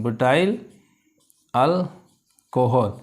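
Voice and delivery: Alcohol split into अल, then कोहल, drawn out; slow dictation. Shown as a demonstration. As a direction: dictate alcohol slowly in two parts. Teacher dictates the name.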